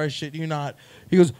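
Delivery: normal talking voice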